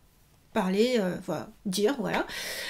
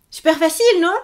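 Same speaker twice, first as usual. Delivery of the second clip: upbeat; 6 semitones higher, 6 dB louder, faster than usual. Real, not acted. Teacher cheers the easy rule.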